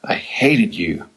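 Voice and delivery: slow, breathless whisper; dying whisper. Retold or imitated. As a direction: barely get the words out, fading. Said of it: "smiles and coughs and says".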